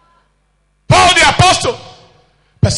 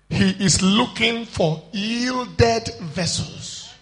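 slow solemn quote; 2 semitones lower, 4 dB quieter, slower than usual. Acted. Slow solemn quoted sermon line.

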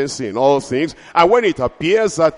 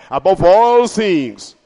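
slow, louder proclamation; preaching; 3 semitones higher, 5 dB louder, slower than usual. Acted. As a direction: lift the blessing up. Preaching blessing.

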